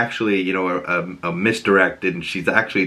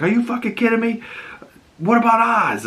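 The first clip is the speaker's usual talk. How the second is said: much higher, fast disbelief; furious at the gun to his head; incredulous anger; 13 semitones higher than usual. Retold or imitated. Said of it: "he's like".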